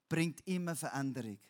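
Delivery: ordinary delivery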